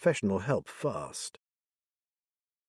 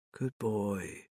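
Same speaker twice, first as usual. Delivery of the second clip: calm soothing; 8 dB quieter than usual. Acted. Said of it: "talking calmly to the dog".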